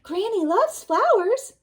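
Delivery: high coaxing wolf voice; sweet-talking wolf; sly wolf. Acted. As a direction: tempt her like a sly wolf.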